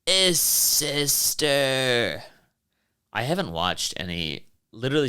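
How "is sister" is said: very slow, stretched-out sister; mock creepy sister; imitating sister. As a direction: drag the word out eerily. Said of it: "I still think about that episode".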